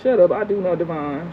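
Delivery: ordinary talk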